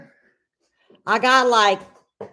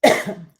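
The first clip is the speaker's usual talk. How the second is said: sudden choking cough. Real, not acted. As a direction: cough hard as it catches. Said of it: "gonna choke".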